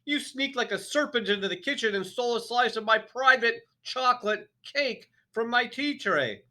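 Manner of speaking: enraged headmistress; furious tirade. A narrator's reading. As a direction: pound every accusation home. Trunchbull's tirade about the cake.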